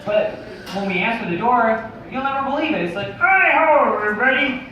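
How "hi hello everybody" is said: raised puppet-frog voice; Kermit the Frog. Acted. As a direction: greet the crowd like a puppet frog. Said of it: "Kermit the Frog here".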